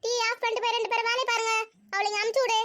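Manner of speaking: stern call across the house; calling out orders. Acted. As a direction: send the squabblers off to work. Mother orders from another room.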